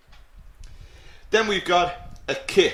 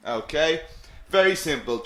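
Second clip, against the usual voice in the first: ordinary talk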